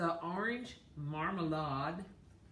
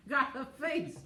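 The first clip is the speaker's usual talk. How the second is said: high, loud laughing words; 7 semitones higher, 5 dB louder, slower than usual. Real, not acted. Laughing at a funny face.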